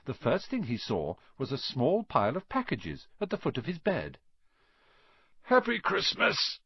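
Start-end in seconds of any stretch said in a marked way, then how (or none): 5.4-6.6 sleepy. A narrator's reading. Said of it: "said Ron sleepily".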